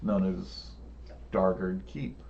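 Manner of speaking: suspenseful storytelling, voice dropping; game master scene setting. Acted.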